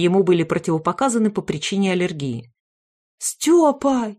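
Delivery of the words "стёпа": high, loud relieved cry; frantic cry. A narrator's reading. Frantic mother finding her son, crying out. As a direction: call out his name in a rush. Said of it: "кинулась к нему обезумевшая Таня".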